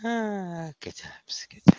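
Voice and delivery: unvoiced whisper, savouring it. Real, not acted. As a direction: breathe it out like a fond memory. Quiet nostalgic aside.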